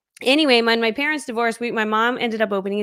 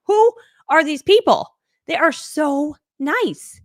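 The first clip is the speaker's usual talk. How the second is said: amazed; 6 semitones higher, 5 dB louder, much slower than usual. Retold or imitated. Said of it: "And I'm like".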